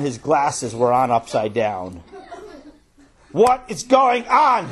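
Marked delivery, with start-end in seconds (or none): 3.3-4.7 high, slow, booming demand; loud voice. Acted. Father bursting in on fighting boys, loud angry shout. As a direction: roar it out, drawing the words out. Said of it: "he said in a loud voice".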